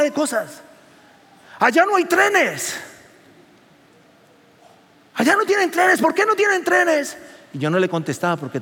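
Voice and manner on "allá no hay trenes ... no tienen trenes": nagging taxi lady. Retold or imitated. Pastor imitating the taxi lady scolding him about trains, raised and needling. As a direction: hammer the point like a scolding driver.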